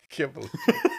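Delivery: high laughing disbelief. Real, not acted. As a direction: laugh through the words. Laughing at friend's rolled r.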